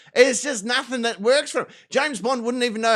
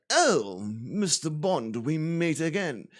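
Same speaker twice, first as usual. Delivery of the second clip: slow, softer movie-villain drawl; Bond villain; 4 semitones lower, 5 dB quieter, slower than usual. Acted. Bad guy greeting Bond in his lair, slow villain voice. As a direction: greet your nemesis with slow, quiet menace. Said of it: "the bad guy is there".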